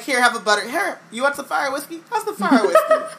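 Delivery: hammed-up cheery mum offering drinks; Molly playing host. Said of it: "Molly being like".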